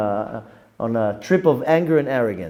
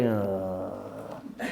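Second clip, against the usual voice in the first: low, soft grunt; imitating a wife's unimpressed grunt; 6 semitones lower, 12 dB quieter than usual. A wife greeting her husband at home, low grunt. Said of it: "or like".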